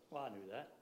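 low, quiet know-it-all shrug; mock dismissive. Preacher imitating listeners who resist instruction, mildly dismissive. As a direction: lightly brush it off as old news. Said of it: "not just sit there and like".

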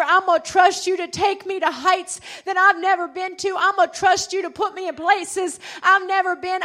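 loud, building preacher's prayer; preaching at full force. Acted. Preacher vowing trust to God, loud and building.

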